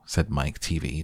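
plain reading voice

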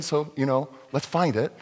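ordinary talk

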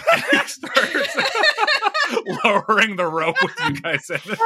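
high, giggling narration; can barely get it out for laughing; laughing through words. Real, not acted.

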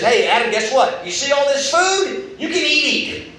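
God's voice pitched high and eager, urging Adam on; a delighted host urging his guest to eat; generous God, excited. Retold or imitated. Preacher voicing God inviting Adam to eat freely, excited. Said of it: "So literally what God said is".

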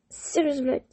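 ordinary delivery

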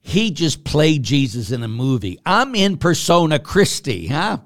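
hammed-up outrage over an actor's fee; mock indignant retort. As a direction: throw it back at him, laughing at the gap. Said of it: "I said".

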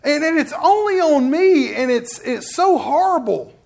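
high, loud, agonized wail of words; hammed-up anguish over a heavy decision; mock despair. Preacher acting out agony over deciding alone, despairing. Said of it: "the despair and the, and the agony".

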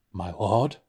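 normal talking voice

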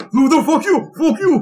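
high, loud, angry Irish caller; freaky Irish accent. Retold or imitated. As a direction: curse in an exaggerated Irish accent. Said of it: "the freakiest Irish accent you can imagine".

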